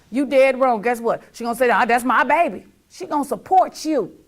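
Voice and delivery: loud, rising preaching; loud peak of the sermon; building shout. Real, not acted. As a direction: hammer it home loudly. Preaching peak about mama.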